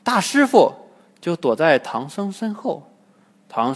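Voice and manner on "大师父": high, louder panicked cry; hurried cry. A narrator's reading. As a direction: cry out for help. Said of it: "慌忙喊一声". (慌忙高喊)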